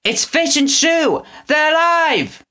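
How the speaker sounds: high joyful cry; overjoyed cry. A narrator's reading. Winnie seeing Fish and Shoe alive, overjoyed. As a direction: burst with relief.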